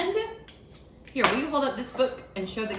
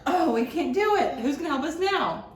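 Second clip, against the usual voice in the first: higher playful dismay; mock despair; 7 semitones higher, 3 dB louder than usual. Librarian playfully lamenting to the child, higher. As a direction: groan it for fun.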